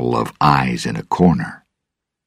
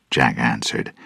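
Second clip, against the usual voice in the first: ordinary delivery, nothing marked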